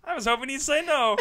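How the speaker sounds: mock disappointment.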